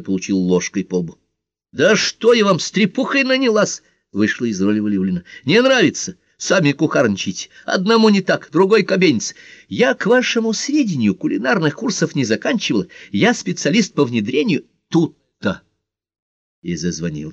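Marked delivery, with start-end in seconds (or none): 1.7-3.8 high-pitched fed-up scolding; woman snapping that she has had enough; indignant outburst. Acted. Fed-up woman drops her role, quarrels. 5.4-15.6 high-pitched fed-up scolding; woman snapping that she has had enough; indignant outburst. Acted. Fed-up woman drops her role, quarrels.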